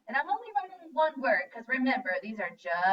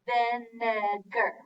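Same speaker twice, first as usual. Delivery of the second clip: Vinegar stretched slowly, syllable by syllable; much slower than usual. Shown as a demonstration. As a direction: draw out Vinegar slowly for spelling. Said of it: "Let's spell vin".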